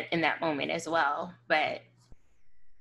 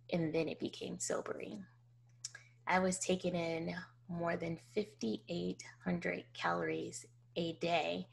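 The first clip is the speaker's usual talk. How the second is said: whispered, much softer and weighed down; sobered whisper; 9 dB quieter than usual. Real, not acted. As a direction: let the shock hush you. Sobering realization of calories.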